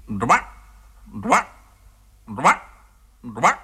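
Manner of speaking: short rising frog croaks, evenly spaced; croaking frogs. A narrator's reading. Distant frogs calling to a passing peasant, croaking. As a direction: croak each word out like a frog in a pond. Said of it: "издали кричат лягушки".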